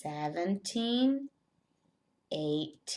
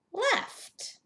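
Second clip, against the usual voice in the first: sudden squeak on one word; squeaky high voice. Acted. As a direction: pop the word up high and playful. Playful teacher acting out ants.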